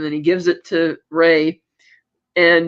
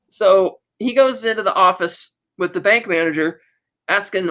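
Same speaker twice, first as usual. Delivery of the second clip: normal talking voice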